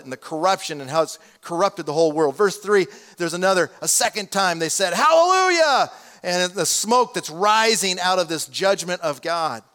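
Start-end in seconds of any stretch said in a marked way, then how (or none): 4.9-5.9 big ringing shout of Hallelujah, much higher and louder; booming shout. Acted. Preacher voicing the heavenly multitude with a booming Hallelujah. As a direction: belt out Hallelujah like a vast crowd. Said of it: "in this loud, booming voice".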